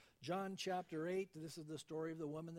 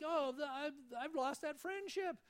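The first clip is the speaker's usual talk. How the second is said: despairing thought; 9 semitones higher, 3 dB louder than usual. Retold or imitated. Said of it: "and you think".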